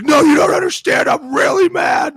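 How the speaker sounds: screaming, high and loud; angry shout. Retold or imitated. Coach yelling at the other coach, furious shout. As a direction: yell it like an enraged coach on the field. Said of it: "And he was like".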